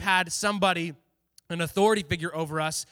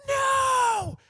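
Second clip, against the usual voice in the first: mock scream; 3 dB louder than usual. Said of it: "screams at the top of his lungs".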